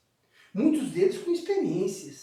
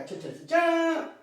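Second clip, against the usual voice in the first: high, slow "ta-da" fanfare; sing-song drumroll for a big reveal; mock fanfare; 6 semitones higher, slower than usual. Teacher unveiling electricity to the class, mock fanfare.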